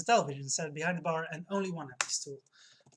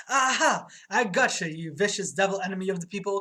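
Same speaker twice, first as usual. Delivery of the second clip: loud gotcha cry, much louder; triumphant cry; 2 semitones higher, 9 dB louder than usual. Acted. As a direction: shout it out in triumph. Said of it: "cries bartender".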